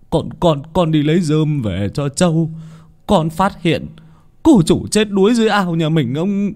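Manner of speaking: high, stammered start building to a long wail; distraught wailing report. A narrator's reading. Servant reports daughter drowned, wailing in panic. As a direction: blurt the terrible news, rising to a loud cry.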